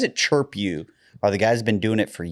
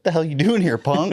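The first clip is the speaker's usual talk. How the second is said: Happy Gilmore quote; 6 semitones higher than usual. Retold or imitated. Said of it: "You know in Happy Gilmore".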